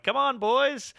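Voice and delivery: high, eager call to friends; excited teenage rallying cry, retold; rallying the boys. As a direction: call the gang along, keyed up. Rallying friends to come along.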